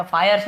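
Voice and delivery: फायर्स opening like "fire"; long-I misreading. Shown as a demonstration. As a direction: say फायर्स with an "eye" glide. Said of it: "फायर्स नहीं पढ़ रहे हैं".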